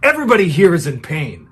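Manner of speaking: imitated angry outburst, high and loud; through gritted teeth. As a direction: snap it loudly through clenched teeth, retelling a classmate's rebuke. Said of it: "through gritted teeth, he just goes".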